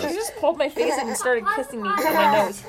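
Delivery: playful laughing distress; laughing plea. Real, not acted. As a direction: laugh through a mock cry for help. Laughing while kid kisses her.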